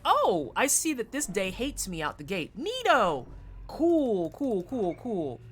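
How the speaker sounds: fake-bright, rising high on Neato, then cool, cool dropping off; mock-cheerful and rising, as if the day is fine; sarcastic cheer. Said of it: "And being like".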